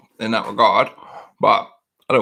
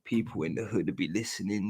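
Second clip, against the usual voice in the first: much softer, slowed to a hush; speaking quietly; 4 semitones lower, 14 dB quieter than usual. Shown as a demonstration. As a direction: drop right down so the walls can't hear. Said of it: "you gotta speak quietly".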